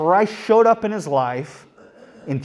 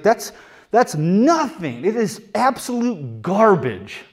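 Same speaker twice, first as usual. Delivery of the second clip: raised, emphatic dismissal, NOTHING drawn out; strong emphasis; 6 semitones higher than usual. Retold or imitated. Preacher voicing Paul's verdict on his achievements, strong emphasis on "garbage". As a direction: punch "nothing" and "garbage" with scorn. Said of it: "It's that kind of emphasis".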